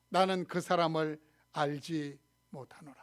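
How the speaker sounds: ordinary delivery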